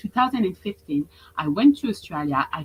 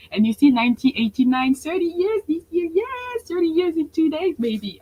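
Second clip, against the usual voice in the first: much higher, louder gleeful squeal; birthday excitement; 10 semitones higher, 5 dB louder than usual. Real, not acted. Coach sharing her birthday news, excited squeal. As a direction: squeal with joy about turning thirty.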